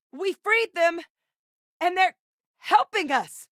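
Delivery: high, excited holler; high-pitched yell in short bursts; combat shout. Acted. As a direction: call the news out across the fight. Calling out during a fight.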